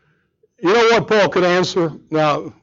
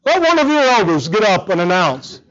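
ordinary talk

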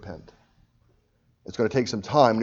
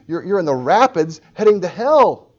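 loud, high preaching alarm; urgent warning; 8 semitones higher, 11 dB louder than usual. Real, not acted. Preacher warning non-Christians about hell, loud and urgent. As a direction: raise the voice sharply in warning.

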